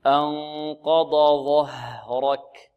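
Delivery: "أنقض ظهرك" slow with a clear break between dad and zha; slow model recitation. Shown as a demonstration. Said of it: "واضح؟". (ترتيل نموذجي بطيء)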